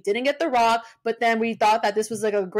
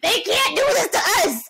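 pitched-up loud protest, hitting do; higher outraged cry; 9 semitones higher, 4 dB louder than usual. Retold or imitated.

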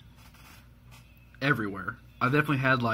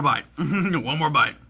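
amused, about to eat; chuckling between words; laughing while talking; 3 semitones higher, 3 dB louder, slower than usual. Real, not acted. Seen 1: Mouth looks empty while talking and laughing; bite only at 2.6.

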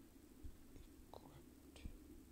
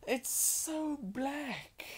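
much higher and slow, stretched out; high drawn-out whine; 13 semitones higher, much slower than usual. Real, not acted. Gamer complaining the screen is dark, in a slow high whine.

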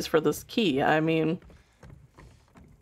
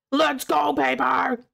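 cheering shout; 5 semitones higher, slower than usual. Real, not acted.

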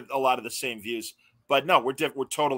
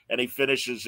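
ordinary talk, nothing marked

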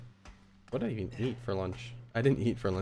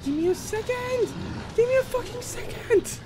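screamed much higher and louder in real rage; furious shout; 4 dB louder than usual. Real, not acted. Gamer screaming at the game to give him a second, enraged. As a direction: scream it at the screen, losing it.

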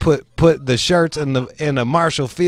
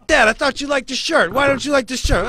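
much higher, pleading mock voice; whiny family member; 14 semitones higher than usual. Relative pleading with grandfather over the shirt, high mock whine. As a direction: play the hurt relative begging dad to like it.

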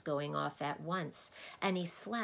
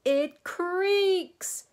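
very high, loud gleeful bellow; 11 dB louder than usual. A narrator's reading. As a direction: bellow the answer in delight. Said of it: "bellowed the din".

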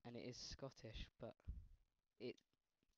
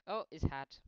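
normal talking voice

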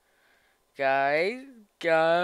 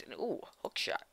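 whispering; 8 semitones lower, 17 dB quieter than usual. Real, not acted. Quiet aside while playing.

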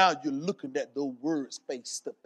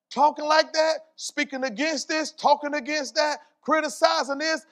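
higher-pitched, driving preacher's cadence; impassioned preaching; 9 semitones higher, 8 dB louder than usual. Acted. Preacher voicing Jesus warning the congregation about their words, impassioned. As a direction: pile up each phrase in a raised, insistent voice.

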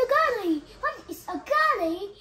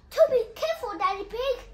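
ordinary delivery

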